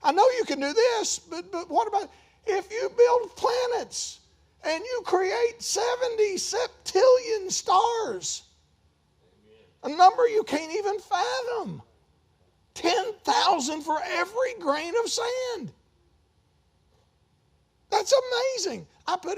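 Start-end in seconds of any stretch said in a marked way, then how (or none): 0.0-2.1 high, rising, doubtful objection; mock doubting whine. Preacher imitating believers doubting God, high and unsure. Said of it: "we limit God and we go". 2.4-18.9 high, slow, near-shouted wonder; awed exclamation. Real, not acted. Preacher marvelling at the stars, high and slow. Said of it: "awed exclamation on God's power".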